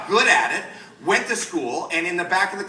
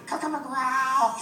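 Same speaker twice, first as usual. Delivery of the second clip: high squeaky little creature babble; drawn-out fuzzy-critter falsetto; Gizmo; 9 semitones higher, much slower than usual. Shown as a demonstration. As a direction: coo gibberish like a tiny creature. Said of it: "Same voice".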